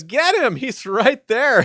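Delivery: high, loud excited battle cry, drawn out; yelled quote. Retold or imitated. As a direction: yell it like spotting a foe mid-fight. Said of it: "He yells".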